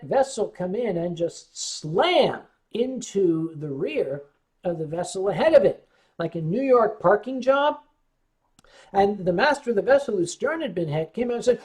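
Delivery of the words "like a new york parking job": ordinary delivery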